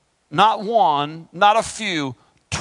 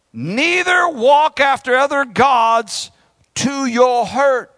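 higher, slow and weighty reading; solemn scripture reading; 7 semitones higher, 4 dB louder, slower than usual. Acted. Preacher intoning Jeremiah's warning to the congregation, slow and solemn. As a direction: intone the verse like a proclamation.

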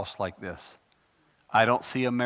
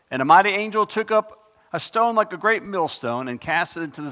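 scripture reading; 3 semitones higher, 6 dB louder than usual. Acted. Reads scripture aloud.

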